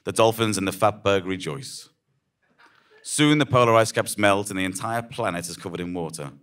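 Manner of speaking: normal talking voice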